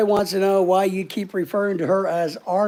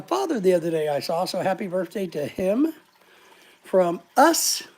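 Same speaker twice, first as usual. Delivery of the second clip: usual speaking voice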